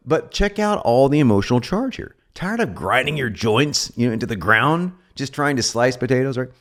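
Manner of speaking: usual speaking voice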